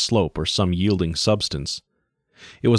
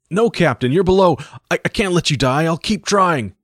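much higher, louder pleading refusal; desperate pilot; 10 semitones higher, 4 dB louder than usual. Acted. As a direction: refuse to leave him.

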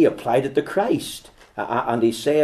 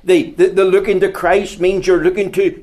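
loud, high, slowing call to turn; preaching at full force; 7 semitones higher, 6 dB louder than usual. Acted. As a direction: plead with the hearers to turn and look. Preacher's closing appeal.

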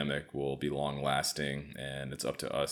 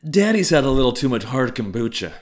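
mocking wife voice; 9 semitones higher, 12 dB louder than usual. Mock-scolding spouse voice.

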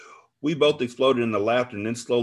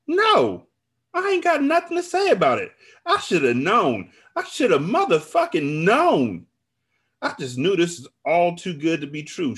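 high, loud, wounded outrage; anguished outcry; 9 semitones higher, 5 dB louder than usual. A narrator's reading. Betrayed friend refuses to talk, crying out. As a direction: cry it out, stretching "known". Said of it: "she cried".

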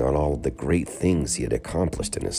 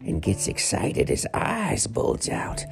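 an ancient sage telling lore; old man voice; 8 semitones higher than usual. Acted. Old man explains legend.

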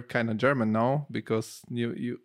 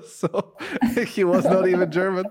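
laughing, fast and louder; 7 semitones higher, 4 dB louder than usual. Real, not acted. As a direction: laugh through the punchline. Laughing at joke.

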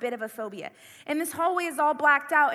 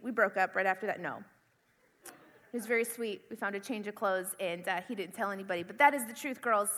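normal talking voice

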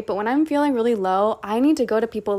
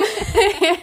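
laugh; 11 semitones higher, 6 dB louder than usual. Real, not acted. Laughing at own joke.

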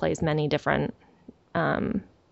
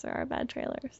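whisper; 7 semitones higher, 12 dB quieter than usual. Shown as a demonstration. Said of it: "repeated in a whisper".

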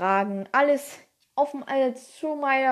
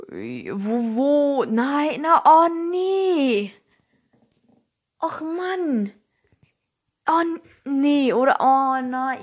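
dismayed groan; 3 semitones higher, 6 dB louder, much slower than usual. Real, not acted. Annoyed at closed file.

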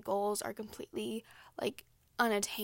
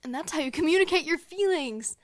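higher, louder mock-cheerful announcement; sarcastic cheer; 6 semitones higher, 10 dB louder than usual. Vlogger to viewers after her rant, mock-cheerful and loud. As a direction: sell it like a proud self-help host.

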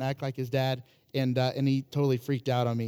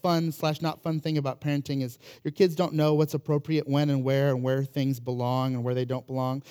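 usual speaking voice

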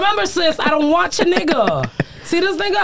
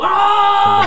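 high, loud mock yell; Lion King roar-shout; 7 semitones higher, 6 dB louder than usual. Imitating the man on the church roof, loud mock yell. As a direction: belt it like the Lion King moment. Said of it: "you know how in Lion King you just stand there and go".

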